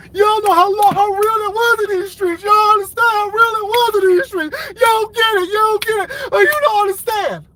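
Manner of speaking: fake-sob the words like a melodramatic mourner; mock wailing. Mocking her critics with a hammed-up crying wail.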